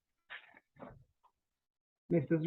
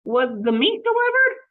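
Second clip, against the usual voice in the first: character voice; 6 semitones higher, 8 dB louder than usual. Acted. Mister Rogers voiced.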